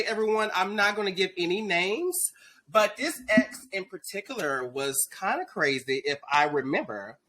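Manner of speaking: usual speaking voice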